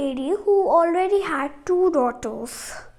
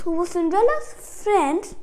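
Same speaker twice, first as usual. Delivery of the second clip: plain reading voice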